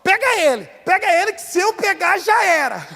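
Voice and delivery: high-pitched mock scolding mother, laughing by the end; a son retelling how his mother ordered the beating, high mock-angry voice; imitating angry mother. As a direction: play the furious mom in a high put-on voice, half laughing. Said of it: "e minha mãe falou assim".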